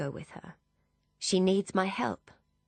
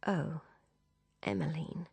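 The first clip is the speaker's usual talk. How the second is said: soft, halting dismay; overcome; 6 dB quieter than usual. A narrator's reading. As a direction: say it softly, unable to go on. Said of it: "and is unable to continue".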